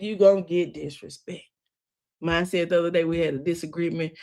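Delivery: ordinary delivery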